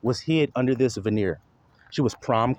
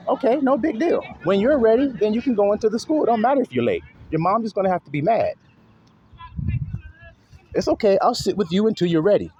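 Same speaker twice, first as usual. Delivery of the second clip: higher, gentle soothing voice to a nervous daughter; reassuring dad; 9 semitones higher than usual. Retold or imitated. Father soothing his anxious daughter at school drop-off, gentle and reassuring.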